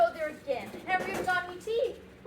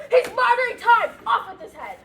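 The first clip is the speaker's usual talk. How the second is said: loud imitated royal yell; imitating the shouting Queen; mock shout; 3 semitones higher, 11 dB louder, faster than usual. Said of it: "The Queen always shouts".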